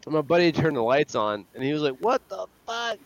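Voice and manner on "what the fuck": high-pitched, startled outcry; mock shocked yelp. Buddy turns the lights on mid-prank, mock shocked.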